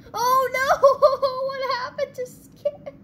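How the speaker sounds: high-pitched cry breaking into laughter; real amused horror; laughing dismay. Real, not acted. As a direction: wail it high through laughter. Laughing at a crash.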